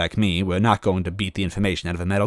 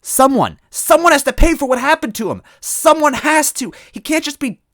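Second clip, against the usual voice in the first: put-on high, loud desperate girlish yell; Toadette yelling; 8 dB louder than usual. Toadette yelling at Smithy over her brother. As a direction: cry out for her lost brother.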